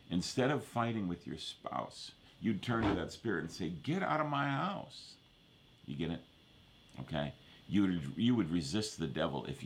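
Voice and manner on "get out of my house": high, drawn-out command to get out; acted rebuke. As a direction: order the intruder out, pitched way up and slowly. Acted rebuke of an intruding spirit.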